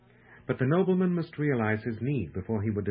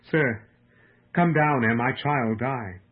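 louder, slow anguished plea; agonised supplication; 5 dB louder, slower than usual. A narrator's reading. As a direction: narrate a desperate cry for help, drawing out the words. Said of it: "In an agony of supplication, he cried".

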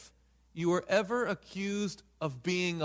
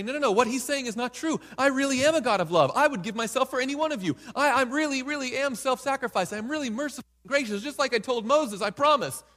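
higher, fast earnest plea; God pleading; 7 semitones higher, faster than usual. Retold or imitated. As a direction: beg them to believe you. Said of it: "God could stand up in heaven and say".

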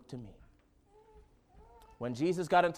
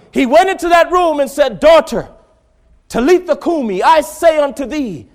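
very loud preaching, pressing hard; rising preaching shout; 12 semitones higher, 15 dB louder than usual. Real, not acted. Preacher warning the congregation, near shouting. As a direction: hammer it home to the church.

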